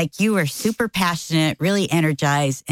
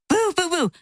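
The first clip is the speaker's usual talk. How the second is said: mock booing; 13 semitones higher than usual.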